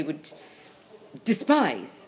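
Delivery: ordinary delivery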